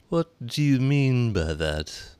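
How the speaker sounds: slow, stern caterpillar voice; drawn-out and stern. A narrator's reading. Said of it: "said the caterpillar sternly".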